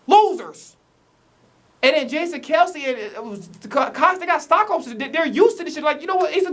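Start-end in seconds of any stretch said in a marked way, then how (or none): 0.1-0.8 LOSERS punched high and loud, spat out; emphatic repeat. Real, not acted. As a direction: hit "Losers" harder the second time, disgusted. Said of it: "uh, losers".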